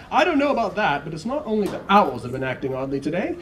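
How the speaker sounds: high, breezy TV weatherman; cheerful forecaster joking with the viewers. Acted. Weatherman answering Ted on air, high and jolly. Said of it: "said the weatherman".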